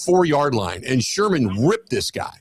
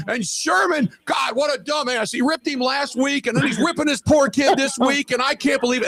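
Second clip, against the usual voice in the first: retold rant; 11 semitones higher than usual. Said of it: "Brian's in mid-rant".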